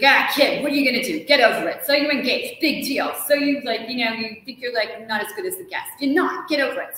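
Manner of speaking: blunt tough character. Retold or imitated. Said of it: "she's just like".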